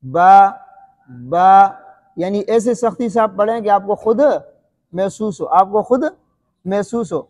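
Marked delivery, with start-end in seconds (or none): 0.1-1.7 با with lips pressed hard, loud burst; wrong squeezed baa. Shown as a demonstration. Teacher to children: overdone lip-pressed با as wrong example. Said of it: "اس طرح کر کے نہیں کرنا ہے".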